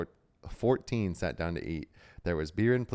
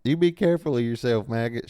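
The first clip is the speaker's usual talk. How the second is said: higher, louder southern farm-wife call; southern accent; 6 semitones higher, 8 dB louder than usual. A narrator's reading. Farmer's wife calling after her husband, in a southern voice. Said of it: "I'm gonna do Farmer Maggot in a southern voice".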